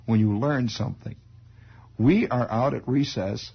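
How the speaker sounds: ordinary talk, nothing marked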